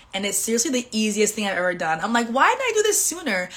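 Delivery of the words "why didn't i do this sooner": high, amazed self-question; playful, much higher why didn't I; excited disbelief. Retold or imitated. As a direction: marvel at your own delay. Retold realization.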